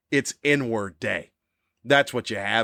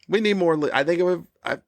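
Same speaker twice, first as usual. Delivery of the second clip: ordinary delivery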